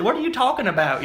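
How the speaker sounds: high, baffled outsider; confused high question. Retold or imitated. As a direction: ask in total confusion.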